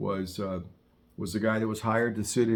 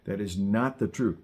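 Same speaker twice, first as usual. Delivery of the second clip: ordinary talk, nothing marked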